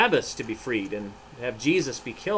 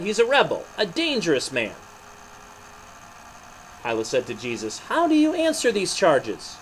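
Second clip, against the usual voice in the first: animated storybook reading with voiced figures; story narration; 2 semitones higher, 4 dB louder than usual. A narrator's reading. Narrator telling children the trial of Jesus, animated. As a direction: read it like a children's Bible story.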